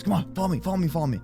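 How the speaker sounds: ordinary delivery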